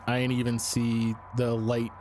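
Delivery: ordinary delivery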